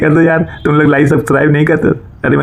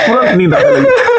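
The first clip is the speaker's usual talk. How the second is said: laughing hard. Real, not acted. Joke repeated with a burst of laughter.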